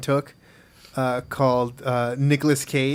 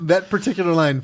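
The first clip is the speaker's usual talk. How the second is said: ordinary talk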